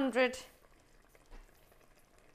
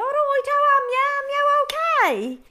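put-on Wolverhampton accent; 9 dB louder than usual. Greeting new housemates in her Wolverhampton accent. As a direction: put on her old Wolverhampton voice, thick and fast. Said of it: "born and brought up in Wolverhampton".

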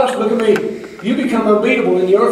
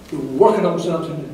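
ordinary delivery

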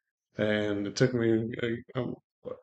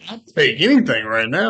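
loud, higher eager exclamation; 4 semitones higher, 10 dB louder than usual. Retold or imitated. Accepting half price for his gun: loud eager reply. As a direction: jump at the offer, louder and higher.

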